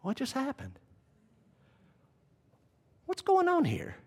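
soft, high-pitched disbelief; hushed bewilderment as Pilate; bewildered inner thought. Acted. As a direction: think it aloud in a small, puzzled voice. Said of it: "He's probably thinking".

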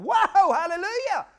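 loud high whoop of joy; overjoyed cry. Pretend. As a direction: celebrate arriving in heaven. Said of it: "I'd be going".

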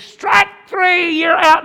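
high, loud umpire shout calling the out; umpire's call. Retold or imitated. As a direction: bark it like a ballpark umpire.

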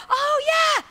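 high and loud, bursting yeah; excited shout. Acted. Churchgoer suddenly remembering, shouting excitedly.

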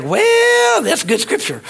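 high squeaky pastor reply; mock the pastor with a high yelp. Retelling the pastor's answer, high and squeaky. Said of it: "And he's like".

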